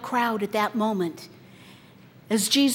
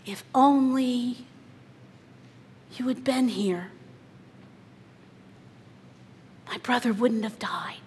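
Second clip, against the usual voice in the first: mild grieving plea. Acted. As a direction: let it sink into a whisper. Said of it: "she echoes the words".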